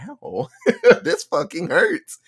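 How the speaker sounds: high, drawn-out imitation of hurting; mock pain. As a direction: yelp it comically with a laugh breaking in. Steven takes a bullet, acted out.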